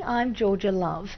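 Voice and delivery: normal talking voice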